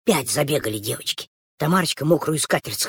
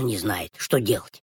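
low kitten voice; 8 semitones lower, 5 dB quieter, slower than usual. Acted. Kitten's part set against girls.

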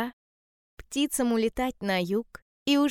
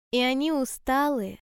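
plain reading voice

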